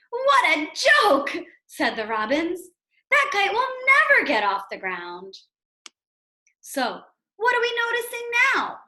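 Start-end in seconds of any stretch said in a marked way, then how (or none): 0.1-1.5 high, slow, loud mocking sing-song; jeering robins. Robins jeer at Toad. 3.1-5.3 jeering robins. Acted. Robins jeer at Toad.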